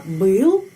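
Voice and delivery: был drawn out slowly with rising pitch; stressed intonation. Shown as a demonstration. Teacher showing where the question stress falls, stressed intonation. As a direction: stretch and lift был as the stressed word. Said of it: "di sini pada kata".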